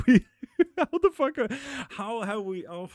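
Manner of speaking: high, loud giggling through the words; laughing. Real, not acted. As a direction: laugh while you try to talk. Overwhelmed by viewers, laughing.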